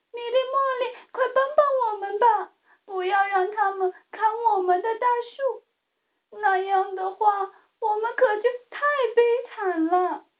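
high, fast, pleading bird voice; sad plea. A narrator's reading. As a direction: rush the plea out, mildly sad. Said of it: "小鸟们伤心地说道". (略带伤心的急切恳求)

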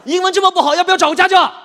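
high, very loud telling-off; exasperated, insulting outburst; scolding shout. Acted. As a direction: yell at a friend over bad English. Friend scolds his bad English.

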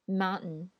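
American mountain without the t; American English. Shown as a demonstration. Teacher reads mountain in an American accent. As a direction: mountain said "moun-n", t dropped, American style. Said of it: "再读美音".

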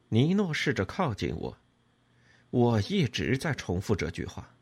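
usual speaking voice

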